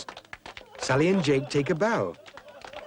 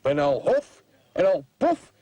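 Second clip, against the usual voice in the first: rising, louder, huffing wolf threat; threatening wolf; 6 semitones higher, 4 dB louder than usual. Acted. Boy as wolf threatening the pig, building louder. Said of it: "says Harry the wolf".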